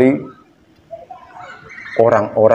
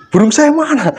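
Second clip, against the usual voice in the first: mock panic; 13 semitones higher than usual. Man at mirror crying out in mock panic. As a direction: yelp it in fake panic. Shocked discovery again.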